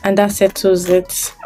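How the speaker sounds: ordinary delivery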